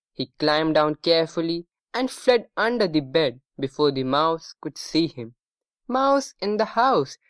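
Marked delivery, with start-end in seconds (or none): 5.9-7.2 high-pitched alarm without much volume; mild shout. A narrator's reading. The whole family cries out together, mild shout. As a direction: raise the alarm, restrained storybook shout. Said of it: "shouted everyone together".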